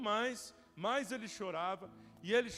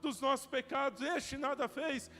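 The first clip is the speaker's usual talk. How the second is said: louder and higher, building to a desperate cry; the thief begs the Lord, voice swelling; rising plea; 5 semitones higher, 6 dB louder than usual. Acted. Repentant thief pleads with Jesus. (o ladrão arrependido suplica a Jesus, cada vez mais alto)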